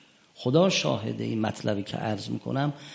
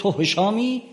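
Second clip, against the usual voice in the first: high, startled cry of recognition; shouted recognition; 9 semitones higher than usual. Retold or imitated. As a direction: call out as if suddenly seeing who it is. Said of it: "یه وقت داد زد". (فریاد غافلگیرانه و زیر)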